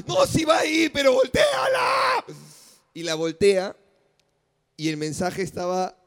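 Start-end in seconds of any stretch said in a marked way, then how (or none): 0.1-2.2 very high, drawn-out shout; hammed-up frantic cry; retold desperate yell. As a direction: plead at the top of your voice, stretching the end. Acted desperate plea in a story.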